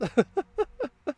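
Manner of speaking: high, loud burst of laughter. Acted. After telling someone they are dead, high laughing.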